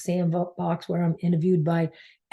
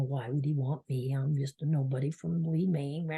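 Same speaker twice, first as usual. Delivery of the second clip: softer, small voice of unworthiness; self-doubting inner voice; 3 semitones lower, 6 dB quieter than usual. Retold or imitated. Her inner critic doubting she is worth his time, said softer. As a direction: quietly put yourself down. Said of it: "triggering unworthiness".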